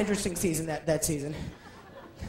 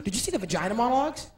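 normal talking voice

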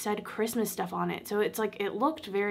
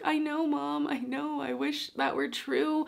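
higher-pitched tearful reply to mom, slowing at the end; retold tearful reply; 5 semitones higher, slower than usual. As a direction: retell your own tearful answer, pitched up, drawn out at the end. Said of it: "I call my mom crying".

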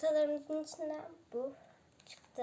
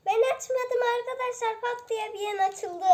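excited; 8 semitones higher, 9 dB louder than usual. Real, not acted. Egg popped open by itself.

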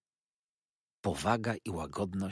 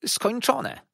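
high, loud matron's cry; busybody mother announcing the end; old mother exclaiming; 7 dB louder than usual. Acted. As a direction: announce it's done, pitched up. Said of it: "mówiła Herod baba, wsłuchując się".

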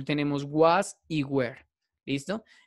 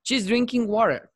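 higher, louder model read; model sentence; 7 semitones higher, 4 dB louder, slower than usual. Shown as a demonstration. Teacher modelling English sentence for students. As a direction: read it out brightly as the example. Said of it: "Vamos a hacerla sencillo".

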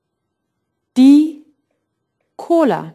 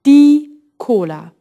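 Die punched louder than Cola; stress on die; 2 semitones higher, 5 dB louder than usual. Shown as a demonstration. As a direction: hit the article Die harder. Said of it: "And Cola is feminine".

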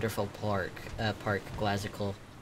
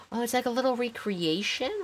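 high, breathless gasp of surprise; suddenly spot something delightful; amazed discovery; 11 semitones higher than usual. Real, not acted. Gasping at a build's detail.

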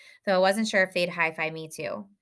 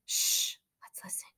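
soft unvoiced whisper; whispered hush; 6 dB quieter than usual. A narrator's reading. Boy hushing friends in the dark, whispered. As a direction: hush them, then whisper.

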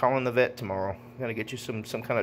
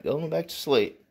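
ordinary talk, nothing marked

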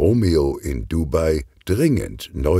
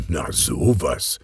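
slow, a touch sheepish; mildly awkward, drawn-out reaction; slightly embarrassed; 3 semitones lower, slower than usual. A narrator's reading. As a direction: play down your embarrassment, taking it slowly. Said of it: "sagt Kugelblitz etwas verlegen".

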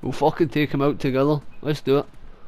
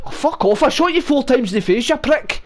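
gloating taunt; 7 semitones higher, 4 dB louder than usual. Real, not acted. Gloating over a killed player.

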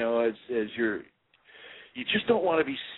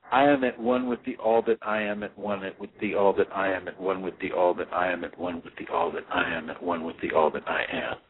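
overlapping mantra; 3 semitones lower than usual. Shown as a demonstration. Meditation teacher shows students the endless mantra, run together without pauses. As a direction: run the repeats together with no pause, fading lower. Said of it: "I would say it like this".

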